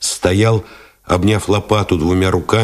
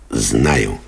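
curt; 7 semitones lower than usual. A narrator's reading. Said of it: "отрезал".

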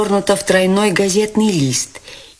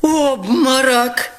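higher, slow startled cry; alarmed cry; 8 semitones higher, much slower than usual. Acted. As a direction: call out in alarm. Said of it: "закричали кругом". (испуганный возглас)

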